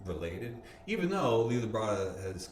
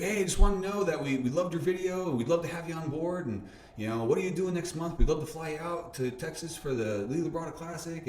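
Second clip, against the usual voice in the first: high, louder enthusiastic pitch; upbeat caller; 10 semitones higher, 4 dB louder than usual. Retold or imitated. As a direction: sell the offer brightly. Retelling Lee's offer on phone.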